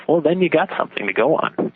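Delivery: ordinary talk, nothing marked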